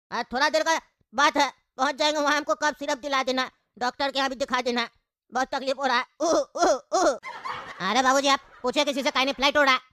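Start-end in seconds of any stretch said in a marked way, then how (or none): 6.2-7.9 fake cough. Father coughs during sick complaint.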